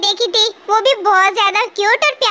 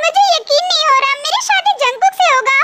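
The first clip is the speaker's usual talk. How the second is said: higher, giddy and breathless with joy; thrilled disbelief; 5 semitones higher than usual. Acted. Bride alone in her room, thrilled about marrying Jungkook, giddy excitement. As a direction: gush like a dream came true.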